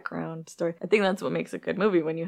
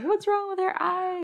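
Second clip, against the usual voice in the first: high, panicked, hammed-up cry; freaking out; 14 semitones higher than usual. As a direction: voice her panic, much higher. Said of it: "really freaking out".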